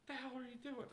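high, thin mock dad voice. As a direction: squeak it like a baffled father. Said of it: "the dad's just like".

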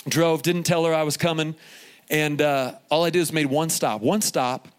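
ordinary delivery

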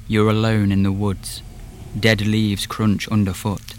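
usual speaking voice

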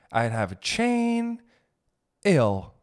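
ordinary talk

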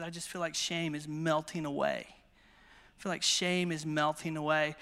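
low, soft, understated retelling; subdued retold tearfulness. Preacher retelling tearful woman's relief during prayer, subdued. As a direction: drop low and soft, slowing on the repeat. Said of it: "she just starts crying".

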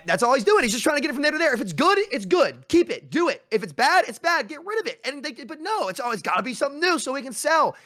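high-pitched, worked-up venting; exasperated rant. Real, not acted. Gamer fed up with companies forcing change, exasperated rant.

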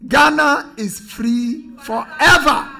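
ringing, drawn-out oratory; Doctor Nkrumah. Retold or imitated. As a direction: declare it like a historic speech to a crowd. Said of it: "Doctor Nkrumah who spoke and said".

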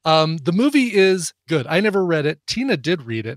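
ordinary talk

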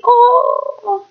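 long high cooed "oh"; drawn-out, high mock-timid "oh"; mock-meek "oh". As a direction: send up weakness with a held high "oh". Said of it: "this is what I see".

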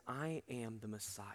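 whispered, slow and low; whispered reveal. Retold or imitated. Jesus telling the woman who he is, whispered. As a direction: hush it like a secret revealed.

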